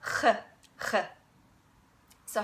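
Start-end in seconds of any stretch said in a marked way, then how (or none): 0.0-0.9 ch as a rough fricative at the back of the throat; raspy back-of-throat ch; German ach sound. Shown as a demonstration. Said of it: "the first sound that you have to learn how to make is".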